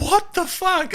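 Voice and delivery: very high-pitched disbelief; imitating astonished mates; retold incredulous cry. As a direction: exclaim it high, as if in hysterics. Retold friends laughing till in tears.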